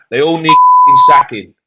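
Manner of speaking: high, loud furious yell; furious shout. Real, not acted. Pundit raging at football's bosses, shouting. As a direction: explode at the people in charge. Climax of rant.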